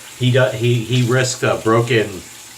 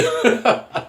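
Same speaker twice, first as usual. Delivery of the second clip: laugh; 13 semitones higher than usual. Real, not acted. Laughing at his own quote.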